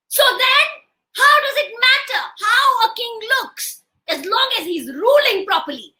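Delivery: high, forceful rhetorical question; acted indignation; challenging wife. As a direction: demand an answer from the crowd. Wife argues with the crowd.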